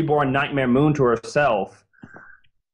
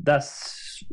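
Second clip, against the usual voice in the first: "thus" whispered soft and toneless; 6 dB quieter than usual. Real, not acted. Host trailing off his argument, whispering "thus". Restarts "thus" in dramatic hush.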